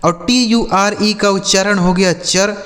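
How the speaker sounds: normal talking voice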